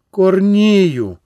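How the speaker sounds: гучніше й вище гукнути «Корнію!»; покликати «Корнію!» голосніше, ніж уперше; гукання сильніше. A narrator's reading. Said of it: "гукнула сильніше". (дружина кличе чоловіка вдруге: гучніше «Корнію!»)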